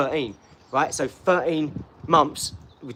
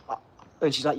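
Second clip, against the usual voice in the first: ordinary talk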